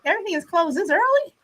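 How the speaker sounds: taken aback, pitch lifted; incredulous question. Real, not acted. Reacts to viewer's closed-everything comment.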